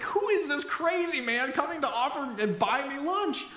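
high-pitched, imagined bafflement; bewildered stranger. Acted. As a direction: act out his astonished thought, high. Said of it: "what this guy who probably was thinking of me".

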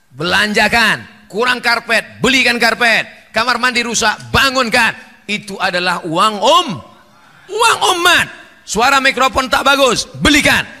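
loud, insistent preaching that keeps climbing; fiery preaching. Acted. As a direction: urge the congregation hard. Preacher urging mosque spending.

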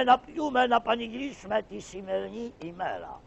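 a grand comic blessing to the newlyweds; pasha blessing. Acted.

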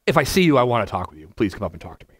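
ordinary delivery

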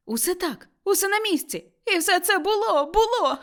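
high, mounting excitement; elated voice rising toward laughter; joyful exclamation. A narrator's reading. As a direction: build up to a delighted cry. Scrooge exclaiming in joyful recognition.

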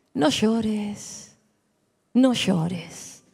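Jesus softly telling the widow not to cry; tender comfort. Acted. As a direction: soothe her gently, almost hushed.

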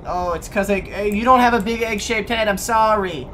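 flustered apology. Real, not acted.

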